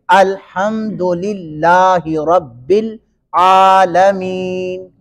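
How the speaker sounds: الحمدللہ رب العالمین recited very slowly, ending on a held "meen"; measured teaching recitation, stretching "aa" and "ee" in العالمین; long final vowel. Shown as a demonstration. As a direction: draw out الحمدللہ رب العالمین syllable by syllable. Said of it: "اتنا مین کو لمبا کریں گے".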